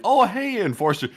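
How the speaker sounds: bright, friendly hello pitched high; friendly greeting. Pretend. Fan spotting the streamer, cheerful high hello. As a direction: greet him like a happy fan. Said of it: "approach with a friendly".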